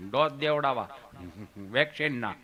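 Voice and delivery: lightly voiced low-pitched friend; friend's voice. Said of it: "મને કે".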